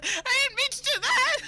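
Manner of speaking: high, loud, hammed-up wailing; mock wail. Player wails along with regretful father.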